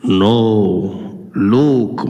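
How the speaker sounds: very low slow boom; deep Vader voice. Acted. Darth reveals himself to Luke in a deep boom. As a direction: loom over him as the villain.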